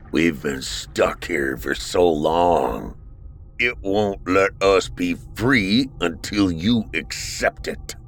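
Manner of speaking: put-on a weary old man pleading.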